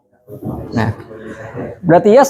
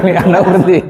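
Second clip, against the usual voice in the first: laughing; much faster than usual. Real, not acted. Student laughing at own guess in class. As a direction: answer it through a laugh.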